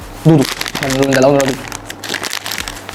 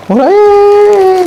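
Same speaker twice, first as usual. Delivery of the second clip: dramatic wail; 5 dB louder, much slower than usual. Acted. Mock-dramatic drawn out question.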